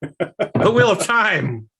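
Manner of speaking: slow, high dramatic title call; drawn-out announcer. Acted. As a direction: stretch the title like a show host. Announcing the episode topic.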